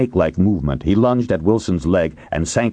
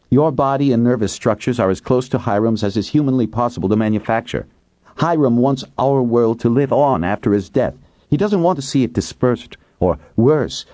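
a touch higher, accusing; lightly voiced woman; 6 semitones higher than usual. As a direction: accuse him calmly. Kate voiced.

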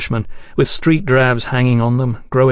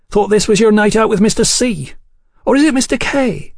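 put-on high-pitched, prying girlfriend voice; high, playful questioning; raucous Hetty, teasing; 9 semitones higher than usual. A narrator's reading. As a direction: tease a friend about her gentlemen, pitched well up. Hetty teases Sybil about her date.

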